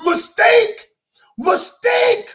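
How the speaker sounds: high game-show buzzer cry of mistake; mock buzzer call. Shown as a demonstration. Rejecting the bad apology example, high mock buzzer call. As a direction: call it out like a wrong answer.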